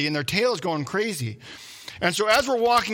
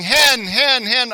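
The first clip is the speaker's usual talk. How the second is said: loud, high repeated shout of hen; yelling; 6 semitones higher, 9 dB louder, much slower than usual. Retold or imitated. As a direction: keep yelling hen over and over. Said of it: "They keep yelling".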